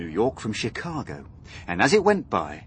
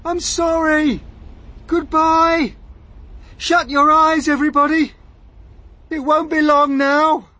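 high, loud farewell shouts; desperate cry; 9 dB louder than usual. A narrator's reading. James says farewell as they plummet, desperate cries. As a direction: cry each phrase out in despair. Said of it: "cried James".

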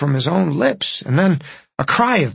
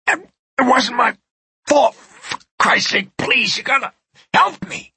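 much higher, breaking plea; desperate begging; 10 semitones higher than usual. Acted. Dying man begs his old nemesis for help. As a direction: beg for your life.

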